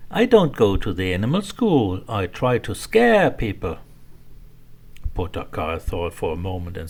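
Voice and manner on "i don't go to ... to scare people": lightly voiced ghost, louder; a cheerful little ghost, hint of a laugh. Ghost answers the hippo, lightly voiced and a bit louder. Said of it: "The ghost laughed and said".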